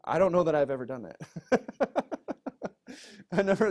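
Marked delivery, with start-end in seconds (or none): 1.2-2.9 a burst of high laughter at himself. Real, not acted. Pastor laughing at his own weakness, high laugh. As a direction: laugh it off, amused at yourself.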